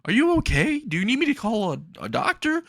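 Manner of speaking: high-pitched, fretful voice; panicked apology. Retold or imitated. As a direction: plead in a worried squeak. Panicked apology after injuring his mentor.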